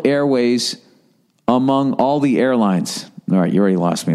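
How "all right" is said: usual speaking voice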